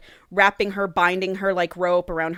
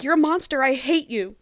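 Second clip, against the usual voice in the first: higher-pitched hurt accusation; mock anguished outburst; 6 semitones higher than usual. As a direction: fling it out as a wounded, retold cry. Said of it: "And she's like".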